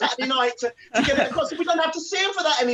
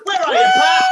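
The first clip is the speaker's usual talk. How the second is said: high, loud holler; calling out; 8 dB louder than usual. Real, not acted. Host summoning Pat to the stage, calling out. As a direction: shout for the missing act.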